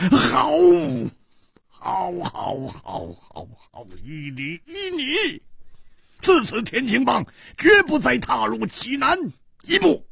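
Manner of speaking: high strained cry, dropping then surging; hoarse, furious surrender. A narrator's reading. Song Gang gives in and swears to leave Jinan, hoarse with fury. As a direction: force out the vow in anguished rage. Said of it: "嘶声说".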